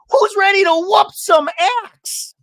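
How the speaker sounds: very high, loud hammed-up holler; Shannon. Imitating her grand entrance at axe throwing, high mock holler. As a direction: belt it slowly as a big entrance line. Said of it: "And Shannon's like".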